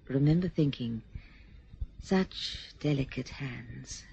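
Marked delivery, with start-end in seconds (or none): 2.0-4.0 tender. Acted. Dreamy memory of first meeting.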